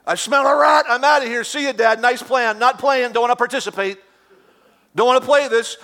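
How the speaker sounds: high, loud mock panicky son; hammed-up scared Isaac bailing; suspicious Isaac. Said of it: "I'm like".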